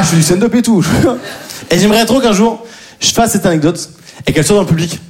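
ordinary talk, nothing marked

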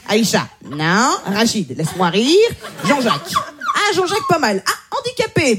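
flick through the CVs and reject names; sorting recruiter. Retold or imitated. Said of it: "il fait".

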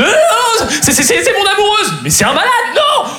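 voix très aiguë qui bégaie; panique jouée. Retold or imitated. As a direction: se défendre en criant haut, affolé pour rire. Poursuivi, il crie pour se justifier. (panique jouée, suraiguë)

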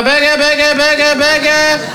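high, repeated vulture-like jeer; mocking taunt. Family pouncing on a stutter, high mocking taunt. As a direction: jeer it over and over.

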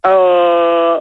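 low vowel held on request. Real, not acted. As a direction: drop the ah down deep. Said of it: "maintenant un A plus grave".

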